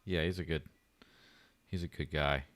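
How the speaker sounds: ordinary talk, nothing marked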